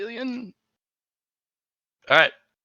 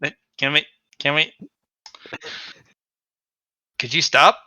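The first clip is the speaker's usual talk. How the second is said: ordinary talk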